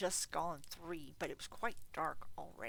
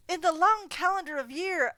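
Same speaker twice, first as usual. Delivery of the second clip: loud, high, heartfelt plea; earnest nephew; 11 semitones higher, 12 dB louder than usual. Acted. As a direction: speak up for Christmas sincerely. Nephew speech continues.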